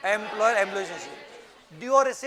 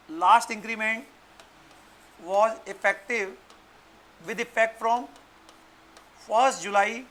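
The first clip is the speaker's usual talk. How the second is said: slow dictation, phrases repeated; dictating; much slower than usual. Shown as a demonstration. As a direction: spell it out slowly for writing. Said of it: "लिखो full stop".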